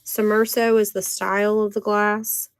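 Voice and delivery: normal talking voice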